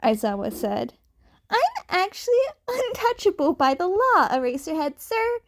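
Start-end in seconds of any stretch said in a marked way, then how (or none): 1.5-5.4 put-on higher, sweet innocent kid. A narrator's reading. Cat kid claiming legal immunity, innocently high. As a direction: say it like you did nothing wrong. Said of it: "Deku said innocently".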